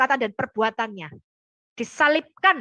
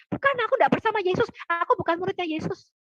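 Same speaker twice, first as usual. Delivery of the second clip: quick, higher panicky denial; frightened denial; 5 semitones higher, 3 dB quieter than usual. Retold or imitated. Peter to the crowd, denying Jesus in a quick mock panic. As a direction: blurt it out like a scared man caught. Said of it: "Petrus lari ketakutan".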